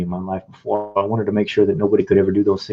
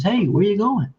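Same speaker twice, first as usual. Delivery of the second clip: high, gentle call after someone walking away; nice soft voice; 12 semitones higher than usual. Retold or imitated. Army recruiter softly calls after a discouraged young man, high and gentle. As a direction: sweetly call him back as he leaves. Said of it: "nice, soft voice".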